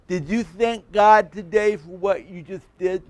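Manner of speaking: a hint of the classmate's voice, pointed question; mimicking classmate. Classmate asks the pastor a pointed question, lightly imitated. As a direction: challenge him gently. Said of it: "I'm gonna mimic him".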